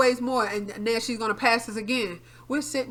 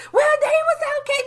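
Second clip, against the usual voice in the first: mimicking mom; 5 dB louder than usual. Mother's dismissive reply voiced.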